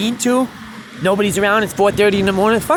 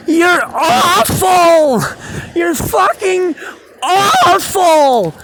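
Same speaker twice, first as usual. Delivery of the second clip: high, loud, slow jeering yell, half laughing; yelling out the window; 12 semitones higher, 5 dB louder, much slower than usual. Retold or imitated. Neighbor yelling out the window at the street musician, slow jeering yell. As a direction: drag every word out like a heckler. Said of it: "The dude yells out the window".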